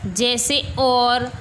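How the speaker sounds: usual speaking voice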